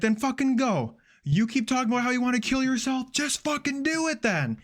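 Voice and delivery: mock nasty snapping, pitched high. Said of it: "she starts getting so nasty".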